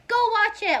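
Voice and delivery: loud, high-pitched order to go; emphatic urging. Real, not acted. Vlogger urging viewers to see her favorite show, emphatic urging. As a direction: shout it like an urgent plug.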